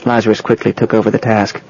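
ordinary delivery

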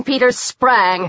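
plain reading voice

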